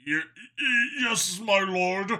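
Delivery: frightened, stuttering underling dragon; stammering fear. Acted. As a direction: stammer it out, terrified of your lord. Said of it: "the other dragon stammered".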